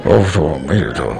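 mock the congregation mumbling behind masks; mocking mumbled plea. Preacher mimicking the masked congregation, mumbling low and laughing. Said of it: "glad you had on masks".

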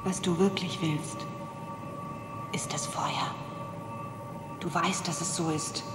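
seductive whisper. Acted. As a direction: breathe it into his ear. Seductive demon tempts Matthew. (verführerisches Flüstern)

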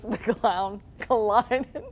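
trying not to laugh. Real, not acted. Anchor giggling through story.

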